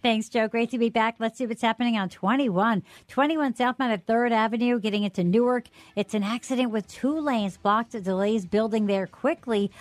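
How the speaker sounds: even, polished traffic-reporter delivery; radio traffic report. Real, not acted. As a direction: read it as a live radio traffic update. Reads traffic report on air.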